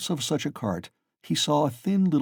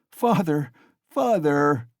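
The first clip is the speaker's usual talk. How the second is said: higher, a touch of alarm; crying out; 4 semitones higher, 3 dB louder, slower than usual. A narrator's reading. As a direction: call to his father, frightened. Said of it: "he cried".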